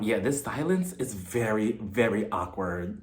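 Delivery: low, soft and slow, trailing down; awkward. Retold or imitated. Margaret calls out the silence at dinner, awkwardly. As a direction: imitate someone calling out a painful silence. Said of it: "it becomes super awkward".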